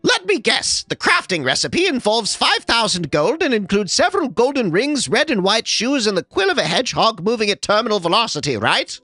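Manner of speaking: very high, fast squeaky mockery; high-pitched sarcastic voice; sarcastic artificer. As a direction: rattle off the recipe with sarcasm.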